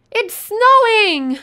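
high, loud, slow excited exclamation; amazed. Real, not acted. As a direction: draw it out in gasping wonder. Thrilled discovery of snow.

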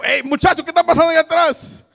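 loud, high call to the back. Real, not acted. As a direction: holler across the room. Calls out to the back.

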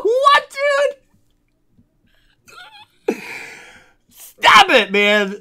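real, very high and loud, almost squealing; stunned disbelief. Real, not acted. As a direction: protest the luck in wild disbelief. Disbelief at rare pull.

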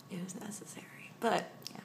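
quick joking whisper. Real, not acted. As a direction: sussurro brincalhão. Whispered joke aside.

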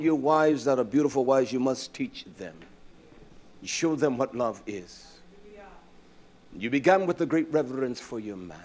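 normal talking voice

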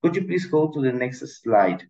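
ordinary talk, nothing marked

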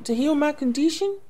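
put-on a girl fumbling a lie; nervous young princess.

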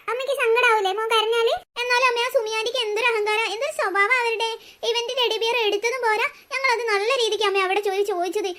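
usual speaking voice

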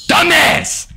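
high, loud shout of the insult; yelled insult. Real, not acted. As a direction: hurl the word at him. Yelling insult at friend.

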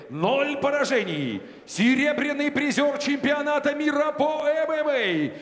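usual speaking voice

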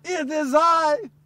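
slow, high calm reassurance; voice of Jesus. Acted. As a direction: speak slowly to calm frightened men. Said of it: "The voice said".

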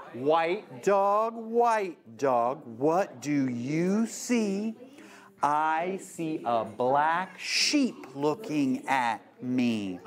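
slow, sing-song picture book reading; read-aloud to kids. Shown as a demonstration. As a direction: draw out each line for little listeners.

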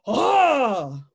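loud mock attack cry; pouncing female's cry. Female tarantula shooting up out of her hole, loud pouncing cry. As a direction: burst out with a sudden loud "ah". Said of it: "she comes shooting up out of there like".

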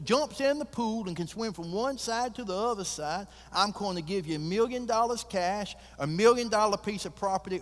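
high, loud showman voice laying out the offer; a swaggering rancher tempting the crowd; boastful host making a big announcement; billionaire's challenge. Acted. Billionaire announces shark-pool challenge.

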